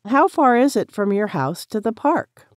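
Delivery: ordinary talk, nothing marked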